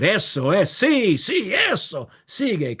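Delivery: high, excited shouts of approval; crowd crying out. Retold or imitated. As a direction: egg the speaker on from the crowd. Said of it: "they would cry out".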